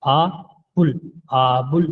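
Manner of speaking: फा बुल said slowly, with a clear बुल; slow pronunciation demo. Shown as a demonstration. Teacher models फा बुल for students, slow and clear. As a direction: spell out फा बुल slowly, one part at a time. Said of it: "पे की बजाय बे".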